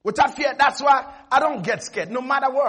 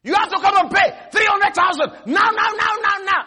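higher, louder pushy debt collector; demanding caller; 6 semitones higher, 4 dB louder than usual. Acted. Preacher voicing the debt collector's phone threat, loud and pushy.

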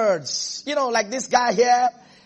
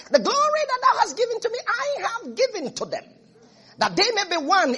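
much higher, ringing recitation; exalted scripture quote; 12 semitones higher than usual. Acted. Preacher quoting Jesus' prayer before he left, exalted. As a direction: lift the verse high above normal speech.

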